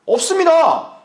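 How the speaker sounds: high, loud, emphatic reply; immediate answer. Shown as a demonstration. Believer admits having no money, high loud immediate answer. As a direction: fire back high and loud. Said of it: "즉각적으로 대답을 해야죠".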